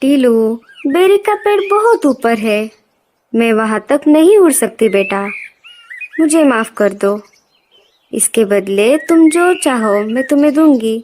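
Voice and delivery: lightly voiced mother soothing her child; apologetic mother. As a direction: plead gently with an upset son. Mother bird answers crying son. (बच्चे को मनाती माँ की आवाज़)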